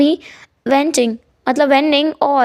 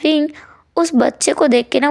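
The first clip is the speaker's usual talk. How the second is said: ordinary delivery, nothing marked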